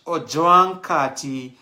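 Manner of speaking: Ojwang said loud and slow, each syllable leaned on; emphatic name. Shown as a demonstration. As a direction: hit Ojwang hard and slow. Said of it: "Usisema tu Oj".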